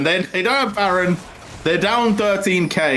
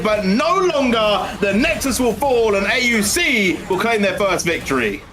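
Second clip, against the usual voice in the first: excited play-by-play; 7 semitones higher than usual. Acted. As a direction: call the fight with rising hype. Live play-by-play of fight.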